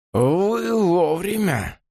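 high, slow rattling voice; thin reedy voice. Acted. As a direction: rattle it out unpleasantly. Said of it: "неприятным дребезжащим голосом".

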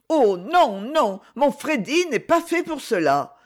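distressed, lightly voiced plea; tense protest. A narrator's reading. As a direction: protest in a high, strained voice. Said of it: "murmura-t-elle, les traits crispés".

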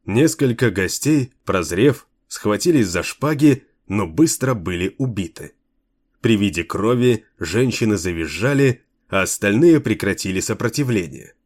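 plain reading voice

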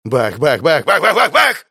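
thudding steps building to high shouts; booming footsteps. A narrator's reading. Imitates monster's steps speeding nearer. (грохот шагов, нарастающий до крика)

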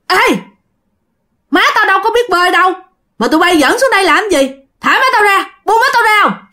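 high-pitched, insistent demands; urgent protective son. Acted. Son's ghost orders river children off his mother, urgent and high. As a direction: call out sharply to make them let go.

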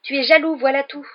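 put-on high, loud boyish accusation; defiant retort. A narrator's reading. Small fan defending Harry against Malfoy, defiant. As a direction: throw it boldly at the bully. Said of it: "lança Colin".

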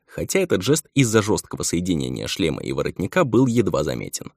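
ordinary delivery, nothing marked

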